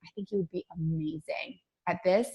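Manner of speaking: hushed, sincere belief in someone; heartfelt encouragement. Shown as a demonstration. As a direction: look them in the eye and say it softly. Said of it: "look them in the eyes and go".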